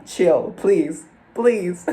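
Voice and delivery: high, loud playful begging; comically exasperated plea; mock pleading.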